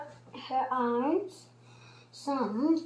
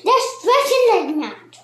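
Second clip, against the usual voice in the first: excited; 6 semitones higher, 7 dB louder than usual. A narrator's reading. Mrs Twit voiced in story.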